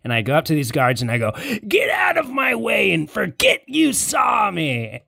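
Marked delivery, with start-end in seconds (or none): 1.7-5.0 high-pitched intimidating yell; mock screaming. Strongman charging at two guards, screaming high. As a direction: scream it to scare them off. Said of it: "And you came in screaming".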